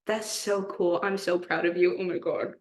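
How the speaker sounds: ordinary talk, nothing marked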